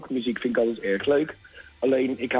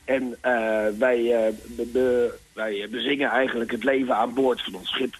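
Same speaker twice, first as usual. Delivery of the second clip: ordinary talk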